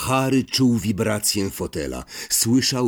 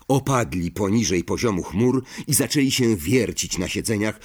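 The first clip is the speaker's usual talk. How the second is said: plain reading voice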